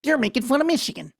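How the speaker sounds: lightly voiced squeaky politician; mock Haley Stevens voice. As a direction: poke fun at her by copying her voice. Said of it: "she starts up".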